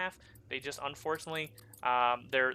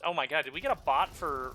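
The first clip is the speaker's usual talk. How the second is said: ordinary talk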